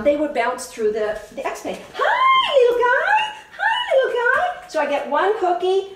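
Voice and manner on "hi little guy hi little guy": puppy talk. Real, not acted. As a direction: coo at the little puppy, pitched way up. Greeting the puppy in the pen.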